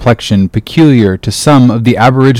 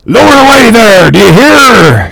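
shouting across the deck; 11 semitones higher, 4 dB louder than usual. A narrator's reading. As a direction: bellow the order to the far boats. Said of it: "shouting across the deck".

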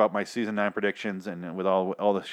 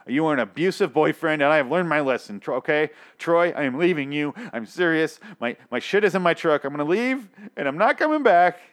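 hammed-up ultimatum, rising higher as it goes; mock firm breakup; 4 semitones higher, 4 dB louder than usual. Wronged partner leaving abusive boyfriend Troy, hammed-up defiance. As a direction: build to a dramatic walkout threat.

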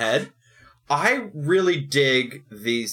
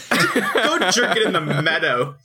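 laughing; 6 semitones higher, 3 dB louder, slower than usual. Real, not acted. Laughing at a crude joke.

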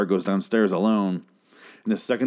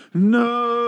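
outraged no; 14 semitones higher than usual. Real, not acted. Host reacting to the plot in disbelief. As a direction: cry out in disbelief.

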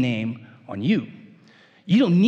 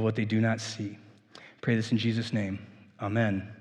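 hushed congregational prayer; 3 semitones lower than usual. Acted. Leads a closing prayer.

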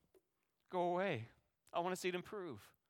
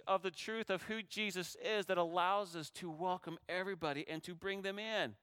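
normal talking voice

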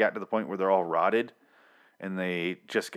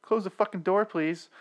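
raised pitch, imitating a bothered woman; mock annoyed woman; 9 semitones higher than usual. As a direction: put on a higher voice for her complaint. Imitates woman interrupted in the bath.

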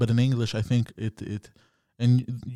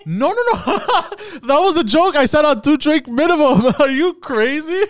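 very high, loud, laughing denial; laughing protest; 6 dB louder than usual. Real, not acted. Man says it was a joke, laughing in protest. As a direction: protest through real laughter.

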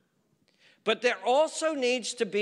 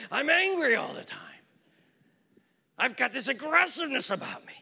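put-on high, fast complaining teen; teen son voiced again; 8 semitones higher than usual. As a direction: play the restless boy griping.

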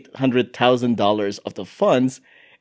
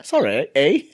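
mock Canadian accent. Host guessing at how Halifax talks, mock Canadian accent. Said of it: "what the Canadian accent is in Halifax".

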